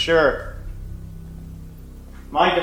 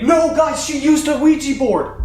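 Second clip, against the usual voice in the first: shocked excitement; 12 semitones higher, 4 dB louder than usual. Real, not acted. Shocked by device's word.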